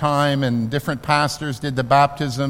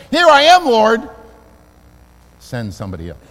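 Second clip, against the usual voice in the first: reluctant dodge; 4 semitones higher, 5 dB louder than usual. Shown as a demonstration. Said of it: "the limiting phrase in the kingdom of God".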